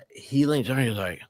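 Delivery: usual speaking voice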